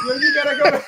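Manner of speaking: yelling through laughter; laughing shout. Real, not acted. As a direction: burst out laughing, loud and high. Cracking up at a funny clip.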